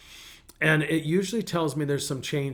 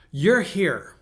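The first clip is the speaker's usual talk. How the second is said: firm, louder, higher-pitched admonishment; stern; 4 semitones higher, 6 dB louder than usual. Retold or imitated. Pastor retelling his words to a lamenting lady, stern. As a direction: lay it down firmly. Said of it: "I kinda got stern with her".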